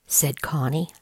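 ordinary delivery, nothing marked

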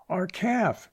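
a high shocked cry; high alarmed outcry; cried out. A narrator's reading. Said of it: "she cried".

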